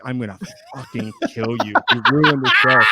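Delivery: hammed-up furious threat; mock angry threat; mock death threat.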